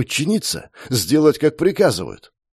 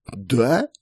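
a short, honestly taken-aback "Да?"; genuinely surprised; 3 semitones higher than usual. A narrator's reading. Markus, surprised by the answer, asks back in genuine surprise. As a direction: caught off guard, asking back. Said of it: "искренне удивился Маркус".

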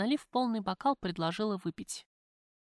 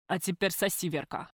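tiefer, fordernder Männerton; schwer atmender Mann. Acted. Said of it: "Тяжело задышал он".

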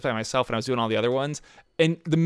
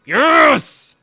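excited yell; 11 dB louder than usual. Real, not acted. Got the item.

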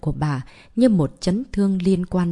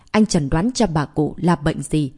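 usual speaking voice

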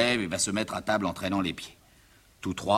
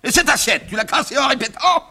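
high, loud exasperated father; outraged scolding; 12 dB louder than usual. Acted. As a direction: shout the questions in disbelief. Father scolds over broken plate.

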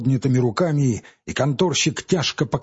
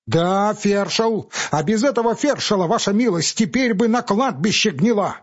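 raised bitter feldsher voice; bitter retort; 8 semitones higher than usual. Acted. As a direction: throw his title back at him. Pavel retorts.

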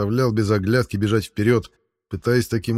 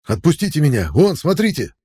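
high loud pleading yell; urgent shout; 7 semitones higher, 4 dB louder than usual. A narrator's reading. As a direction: break free and point, shouting. Struggling free, pointing at man.

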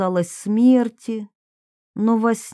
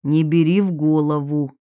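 low male voice, unhurried repeat; Долматов; 5 semitones lower than usual. A narrator's reading. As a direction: repeat it low, without rushing. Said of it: "повторил Долматов".

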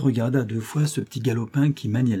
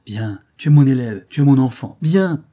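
put-on louder, calling the boy over; emphatic loud invitation; 2 semitones higher, 8 dB louder than usual. A narrator's reading. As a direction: proclaim it to the boy with joy. Master adopting boy joyfully.

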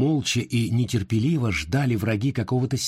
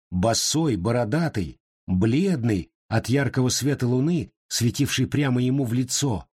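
usual speaking voice

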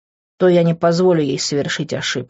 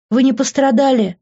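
high-pitched anxious question; a worried mother on the edge of crying; worried, near tears; 9 semitones higher, 4 dB louder than usual. A narrator's reading. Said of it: "Мама уже начала плакать". (встревоженно, на грани слёз)

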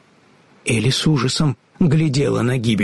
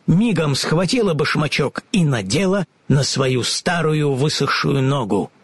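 plain reading voice

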